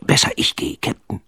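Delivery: whispered offer; softly. A narrator's reading. Pitt quietly offering to climb for Sven. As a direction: say it low, almost a whisper. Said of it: "sagte Pitt leise".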